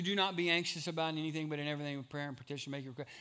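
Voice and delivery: rattled off fast, falling away in pitch and volume; thrown-off quoting. Shown as a demonstration. Preacher shows how people quote scripture, tossed off quickly. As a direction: race through the familiar verse as if by rote. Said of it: "we can throw that off".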